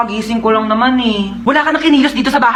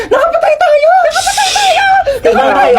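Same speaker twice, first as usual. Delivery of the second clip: shrieking much higher, half laughing, half crying; panicked shriek. Acted. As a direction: lose it completely, screaming in panic. Panic after hitting a man. (sumisigaw sa takot, tumatawa't umiiyak)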